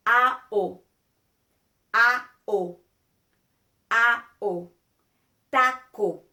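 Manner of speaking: "A-O" loud and clean, then "taco" with the same pure vowels; loud vowel drill into Spanish "taco". Shown as a demonstration. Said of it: "then the fourth time we add the consonants".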